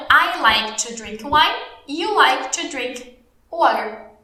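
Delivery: slow, clearly separated teacher English; slow model sentences. Shown as a demonstration. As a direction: repeat it slowly for learners. Teacher repeats the English examples. (frases-modelo lentas e claras)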